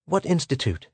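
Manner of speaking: put-on mildly frightened young character; fearful demand. A narrator's reading. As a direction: ask warily, a touch afraid. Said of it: "demanded Pola fearfully".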